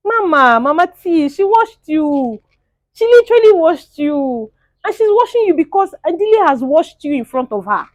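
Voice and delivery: loud, high, stretched-out declaring; emphatic exclaiming. Real, not acted. Vlogger telling the loser she got washed, loud exclaiming. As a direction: call it out loud and high, milking it.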